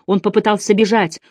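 ordinary delivery

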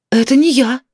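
mildly panicked shout back; frightened cry. A narrator's reading. Woman denies who she is to neighbour, frightened cry. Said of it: "испуганно закричала в ответ Наталья".

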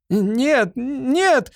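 very high, pleading denials; protesting. A narrator's reading. Servant denies the weapon is broken, protesting. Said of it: "запротестовал Ямбард".

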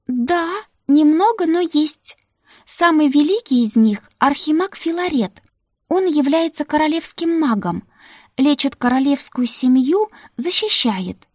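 put-on high eager young maid; Ruta's voice. Ruta vouching to the madam for the new maid, high and eager. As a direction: pitch it up, pleading for the new girl.

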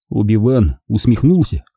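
usual speaking voice

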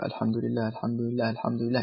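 a soft, counted repetition of الحمد لله; softly repeating dhikr. Shown as a demonstration. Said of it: "ثم قولك".

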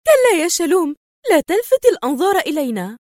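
high, nervy rebuke; edgy, irritable. A narrator's reading. Woman stops her henchman from shooting, edgy and irritable. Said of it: "وهي تقول في عصبية".

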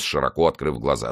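ordinary delivery, nothing marked

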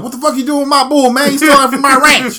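loud, high, outraged yell; mock angry cowboy shouting. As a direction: holler like a furious rancher, hammed up. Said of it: "And Cowboy comes out".

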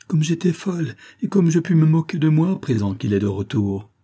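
laughing at her own fears; relieved. Acted. Wife relieved her husband's home, giddy and high.

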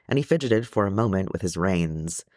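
ordinary delivery, nothing marked

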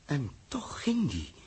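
slow hushed whisper, half to himself. Acted. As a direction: breathe it out in disbelief. Captain stunned by the news.

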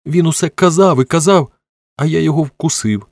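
high-pitched, wounded complaint; plaintive, building wail; tearful child. A narrator's reading. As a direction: pour out the insults like a hurt little boy. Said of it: "Тепер вони плакали вдвох".

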